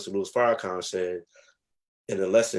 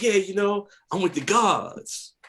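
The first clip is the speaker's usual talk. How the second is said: much higher and slow, proud and naive; naive younger self; 10 semitones higher, slower than usual. Retold or imitated. Telling the neighbor he joined the gods, naive high pride. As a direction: play his teenage self, high and sure of himself. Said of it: "I said".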